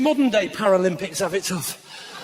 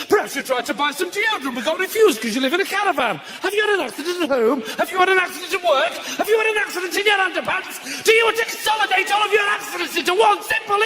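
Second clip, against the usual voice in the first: pushy advert pitch; 6 semitones higher than usual. Retold or imitated. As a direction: fire the questions like a hard-sell ad. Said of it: "bombardment of questions after midnight".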